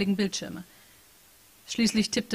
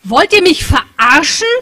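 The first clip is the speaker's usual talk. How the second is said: much louder, slow, higher; loud outraged question; 7 semitones higher, 15 dB louder, slower than usual. Acted. Patricia shouting into the silent room, outraged. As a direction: fling it at the empty room. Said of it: "fragte Patricia laut".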